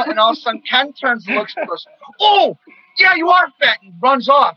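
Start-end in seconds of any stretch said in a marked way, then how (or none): 2.2-3.8 high, loud teasing jab; hammed-up gleeful agreement; mocking Ken.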